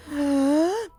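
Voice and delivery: high, rising fangirl gasp; shocked gasp. Acted. Fan offered a special signal by the idol, stunned gasp. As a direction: gasp in starstruck disbelief.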